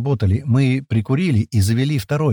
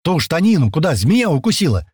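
higher, pressing demand; urgent yelling; 6 semitones higher than usual. Acted. Senior man demands the bite spot, yelling. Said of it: "снова орёт старший".

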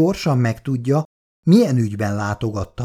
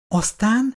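high, rising eager prompt; urging; 10 semitones higher than usual. A narrator's reading. Piri urging Irén to go on, eager. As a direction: press for the rest. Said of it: "Sürgette Piri".

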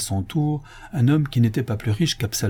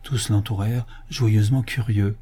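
usual speaking voice

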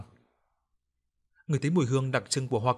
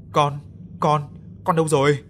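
louder, searching cry; calling for her child; 2 semitones higher, 6 dB louder than usual. Acted. Mother searches for her lost child, calling out. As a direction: call out for the vanished child.

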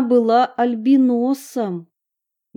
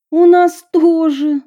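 frightened cry; 9 semitones higher, 9 dB louder than usual. Acted. Girls cry out in the dark.

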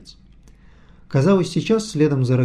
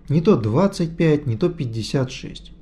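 plain reading voice

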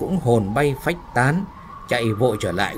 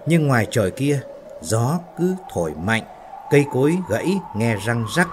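plain reading voice

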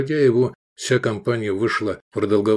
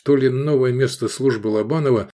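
ordinary delivery

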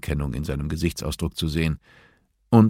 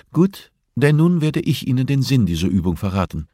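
a touch higher, calm and composed therapist; lightly voiced therapist Hannah; 6 semitones higher, 3 dB louder than usual. Hannah voiced, higher than narration. (leicht gesprochene Therapeutin, ruhig und bestimmt)

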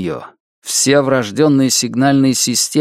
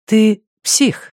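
much higher, defiant female character; Andrea's retort; 10 semitones higher than usual. Acted. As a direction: throw it back at the madman, pitched up. Andrea retorts to the threatening man. (дерзко, высоким голосом)

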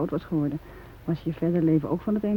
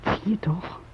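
short hushed whisper; 4 dB quieter, slower than usual. Acted. Hostage answers a fellow hostage in a whisper. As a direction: breathe it, barely voiced. Hostages talking under their breath.

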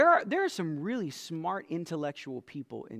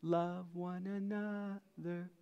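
slow, much softer repeat; soft echo; 3 semitones higher, 7 dB quieter, slower than usual. Acted. Preacher softly echoes the verse to his church, slow and soft. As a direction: let the words fall away gently.

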